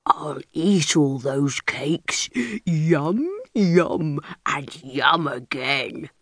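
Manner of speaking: low, slow gloating bear voice; greedy bear. A narrator's reading. Greedy bear gloats over stealing the cakes. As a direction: savour the thought of cakes.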